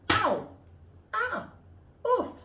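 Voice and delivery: high, loud ow and oof; wolf yelping after a collision; pained yelps. Acted. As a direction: yelp from the bump.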